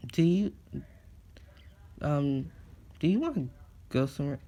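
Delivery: put-on high, hesitant girlish voice; a nervous crush working up courage; shy girl asking out.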